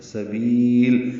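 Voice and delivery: سبیل with the long vowel stretched slowly before stopping on lam; held madd. Shown as a demonstration. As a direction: draw out سبیل, pause on the lam. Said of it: "با کو اب لمبا کر لیں گے".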